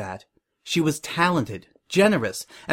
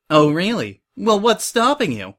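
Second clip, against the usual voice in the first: eager, higher and louder questioning; perked up; 7 semitones higher, 4 dB louder than usual. Acted. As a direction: jump at the chance, pitch climbing. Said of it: "Suri perked up at that".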